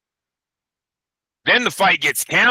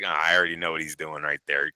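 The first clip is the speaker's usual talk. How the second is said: quiet knowing aside; 11 semitones lower, 6 dB quieter, faster than usual. Retold or imitated. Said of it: "and I was like".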